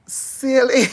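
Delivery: teasing. Real, not acted. Teasing a friend playfully.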